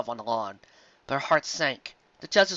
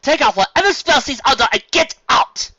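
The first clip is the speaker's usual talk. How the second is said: much higher, louder order; angry demand; 12 semitones higher, 9 dB louder than usual. Acted. Carrot Top orders the brothers, angry and loud. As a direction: command them to undo it.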